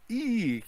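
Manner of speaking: high shrill squeal; scream. A narrator's reading. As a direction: shriek in terror. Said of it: "Kim screams".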